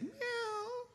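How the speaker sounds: high squeaky mock meow; cat meow. Thief hiding in shop mimics a cat, high mock meow. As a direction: thief fakes a cat's cry. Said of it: "And the guy said".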